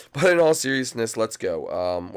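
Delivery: normal talking voice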